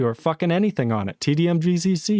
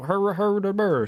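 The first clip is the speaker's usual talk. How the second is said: hammed-up goofy "hur hur" laugh, pitched higher; mock dumb chuckle; 5 semitones higher than usual. Streamer mocks Linux users with a higher goofy "hur hur". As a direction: play a dopey laugh to mock them.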